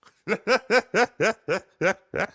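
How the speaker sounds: big high laugh; loud burst of laughter. Real, not acted. Laughs at own joke.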